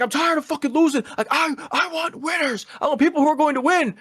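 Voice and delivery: high, hammed-up tantrum voice; mock angry rant. Retelling Vader yelling on the mic: high hammed-up rage. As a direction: imitate a sore loser raging.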